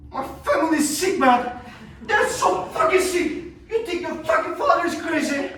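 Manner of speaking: high, loud worked-up rant; agitated outburst. Acted. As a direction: let it burst out, pitched high. Rant about sick family peaks.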